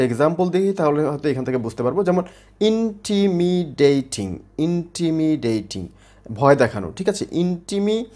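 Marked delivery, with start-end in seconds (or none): 2.6-4.4 ইন্টিমিডেটিং very slowly, in five separate syllables. Shown as a demonstration. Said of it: "পাঁচটা সিলেবলে ভাগ করা হয়েছে". 4.6-5.9 ইন্টিমিডেটিং very slowly, in five separate syllables. Shown as a demonstration. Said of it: "পাঁচটা সিলেবলে ভাগ করা হয়েছে".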